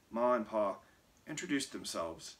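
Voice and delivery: ordinary delivery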